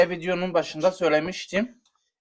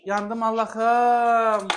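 burning mouth moan; 2 semitones higher, much slower than usual. Real, not acted. Drawn out pained moan.

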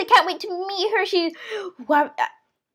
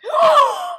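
high, dramatic gasp; shocked gasp; 10 semitones higher, 4 dB louder than usual. Pretend. Child hearing there is no food today, shocked gasp. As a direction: gasp in pretend horror.

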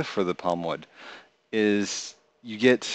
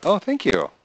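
bright, high, pleased thank you; delighted thanks; 10 semitones higher, 5 dB louder than usual. Real, not acted. Thanking a new viewer.

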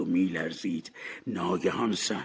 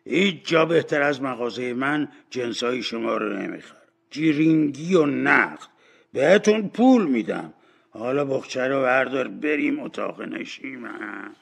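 put-on louder old dealer making his pitch; old shopkeeper; 4 semitones higher, 6 dB louder than usual. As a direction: talk up the deal, drawing out the last words.

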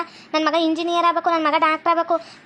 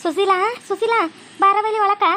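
calling out; 4 semitones higher than usual. Acted. Calling Sushila into the house.

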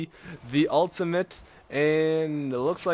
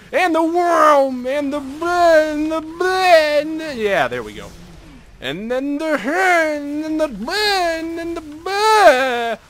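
high, loud, slow exaggerated yells; silly over-the-top fight chant; hammed-up battle cries; 13 semitones higher, 8 dB louder, slower than usual. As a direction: wail each move like a cartoon brawl. Imagined fight play-by-play.

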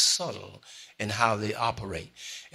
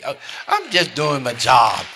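louder, pressed-out insistence on "job"; emphatic repeat; 3 semitones higher, 11 dB louder than usual. Acted. Preacher insisting to congregation, louder and emphatic. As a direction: drive "I'm just doing my job" home, louder.

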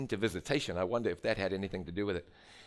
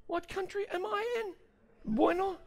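very high, incredulous questioning; baffled. Retold or imitated. Caller hearing Bueno on the phone, baffled. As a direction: squeak out the question in mock bewilderment. Retells bafflement at Spanish answer.

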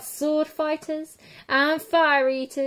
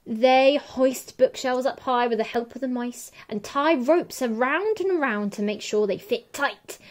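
plain reading voice

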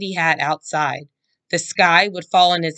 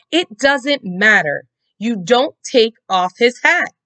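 scolding; 6 semitones higher, 5 dB louder than usual. A narrator's reading. Mom scolding her daughter.

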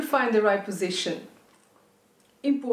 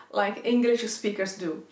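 normal talking voice

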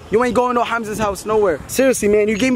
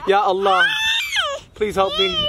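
mock plea. Begging over crying baby.

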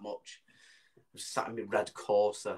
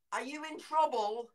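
high, slow worried question; concerned passer-by; 12 semitones higher than usual. Retold or imitated. Bloke asking hidden child, imitated worried high call. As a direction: call it slowly as to a small child.

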